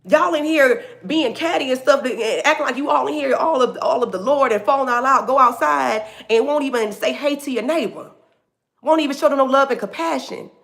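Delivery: a bishop scolding catty churchgoers, preached high and loud; imitating the bishop. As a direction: call out the congregation from the pulpit. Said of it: "He said".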